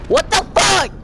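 high, loud burst of disbelief; outraged shout. Real, not acted. Gamer shocked by what hit him, outraged shout. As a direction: yell out in real shock.

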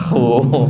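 "Hu" as rasped khuu, pitched low; kha with dhammah. Shown as a demonstration. Teacher demonstrating dhammah, low rasped long khuu. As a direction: scrape the throat into a drawn low "Hu".

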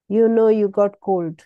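normal talking voice

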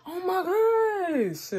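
high, long drawn-out whine; exasperated wail. Real, not acted. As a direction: stretch it into a wail of dismay. Dismay after being sprayed.

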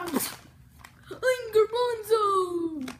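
high, loud, slow hero cry; loud character shout. Pretend. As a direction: belt the name out slowly. Toy character announces itself.

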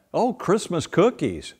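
ordinary talk

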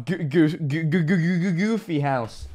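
comic skipping-record stammer; playful stutter. Pretend. Playful stuttered name for house.

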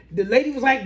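ordinary delivery

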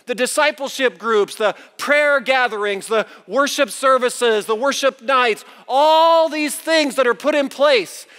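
high, driving sermon delivery; preaching. Acted. Preaches at full force.

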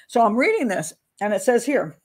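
ordinary delivery

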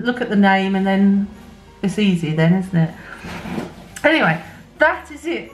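normal talking voice

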